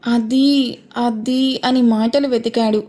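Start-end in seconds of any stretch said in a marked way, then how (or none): 0.1-1.6 halting, searching for words; hesitant. A narrator's reading. Son fumbles for words before his father, hesitant. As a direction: falter and leave gaps between the words. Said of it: "Tarak కాసేపు తడబడుతూ".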